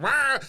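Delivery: high loud mock wail. Mimicking a judge screaming on the dance show, a loud wail. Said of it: "And she went".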